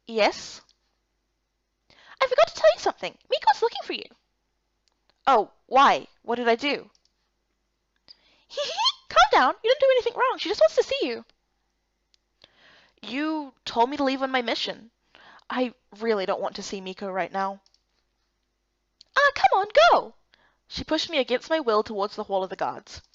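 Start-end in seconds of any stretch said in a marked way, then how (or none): 2.2-11.2 put-on bubbly young woman, much higher; bright, teasing friend voice, pitched up high; cheerful high girl. Ikar voiced by the narrator. 19.2-20.1 put-on bubbly young woman, much higher; bright, teasing friend voice, pitched up high; cheerful high girl. Ikar pushes Erica to go.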